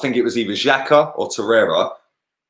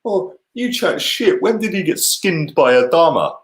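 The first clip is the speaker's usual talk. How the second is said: mocking critics; 8 semitones higher than usual. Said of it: "people were going to me".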